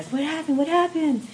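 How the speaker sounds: alarmed protective sister asking her crying little sister what happened; Mama Bear. Retold or imitated. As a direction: rush in like a mama bear demanding answers. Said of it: "was instantly like Mama Bear".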